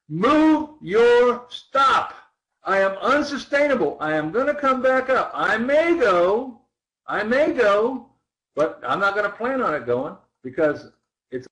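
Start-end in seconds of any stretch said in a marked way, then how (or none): 0.1-2.1 slow, high, loud voice of the chart; chart's voice. Acted. Trading coach voicing the chart to students, slow and loud. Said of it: "Isn't it telling you". 2.6-8.1 high, slightly louder voice of the chart; voicing the chart. Coach voicing the chart to his class, raised. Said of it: "the chart speaking".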